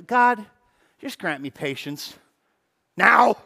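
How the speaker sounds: impatient prayer, NOW barked out; praying for patience through teeth. Pretend. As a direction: demand patience from God, right now. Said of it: "this is me praying for patience".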